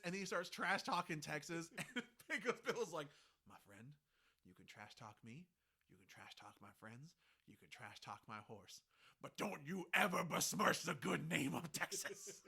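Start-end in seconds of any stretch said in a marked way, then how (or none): 3.5-8.8 quiet menace. Retold or imitated. Pecos quietly warning a man. 9.2-12.4 mock threat. Pecos threatens the trash talker.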